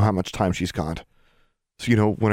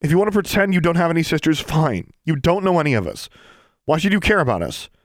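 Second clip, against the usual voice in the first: high, loud, impassioned begging; desperate pleading; 9 semitones higher, 4 dB louder than usual. Acted. Girl begging her estranged sister to save a friend, desperate pleading. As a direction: plead urgently, pitched high and pushed hard.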